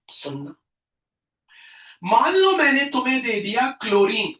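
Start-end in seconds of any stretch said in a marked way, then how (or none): none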